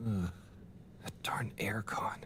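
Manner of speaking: slow, whispered complaint; whispered grumble. Acted. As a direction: grumble it under the breath. Grumbled complaint after sneeze.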